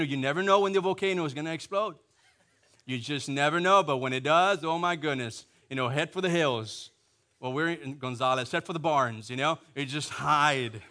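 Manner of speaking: ordinary talk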